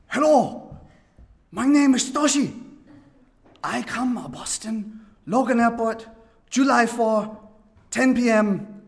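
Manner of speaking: put-on high, loud Japanese-accented English; imitating Toshi. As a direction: mimic the eager Japanese caller on the phone. Phone call from Toshi, imitated.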